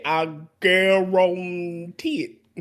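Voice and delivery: slow Cajun "I guarantee it"; Cajun accent. Retold or imitated. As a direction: drawl it like the Cajun TV chef. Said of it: "his accent".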